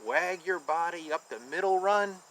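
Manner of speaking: ordinary delivery, nothing marked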